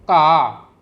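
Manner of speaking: "का" long and loud, with a falling tone; teacher showing learners the Punjabi word for grass, "का" loud and drawn out with falling tone; Punjabi pronunciation. Shown as a demonstration. Teacher introducing the next word, Punjabi pronunciation of "का". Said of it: "उसको पंजाबी में".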